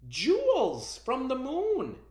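high, loud, slow cry of wonder; amazed cry. Acted. Ancestors marvel to each other at the storm, high and amazed. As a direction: call it out in delight.

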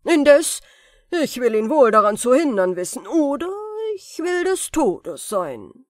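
höher und viel lauter, wütender Zauberer; voller Wut. A narrator's reading. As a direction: zornig herausgeschleuderte Drohung. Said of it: "Voll Wut sagte der Zauberer". (Zauberer tobt über Aladins Glück, wutentbrannt)

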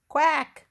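high, loud short quack; duck quack. A narrator's reading. Reader voices Duck for children, high loud quack. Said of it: "said Duck".

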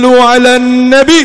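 loud call of sallu ala nabi; loud called-out blessing. Acted. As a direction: shout it out to stop the fight. Calling blessing to quarrellers.

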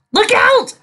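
very high, loud warning shout; alarmed shout. A narrator's reading. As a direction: yell to save her partner. Rainbow warns Dust of spike.

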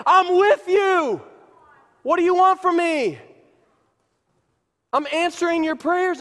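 high, loud emphatic answer; acted exasperated God; exasperated reassurance.

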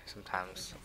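unvoiced muttered whisper; sheepish whisper to himself. Real, not acted. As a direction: drop to a secret whisper. Answers own question about voice.

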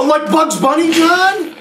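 high, loud disbelieving question; incredulous. Retold or imitated. Teller asking John in disbelief, high incredulous question.

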